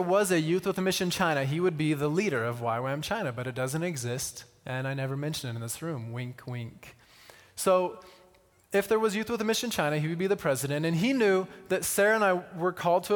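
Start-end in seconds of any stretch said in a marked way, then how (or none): none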